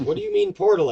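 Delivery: high, loud bewildered outburst; baffled question. Acted. Game master voices character puzzled about a portal, baffled. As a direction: blurt it out, loud and high in confusion.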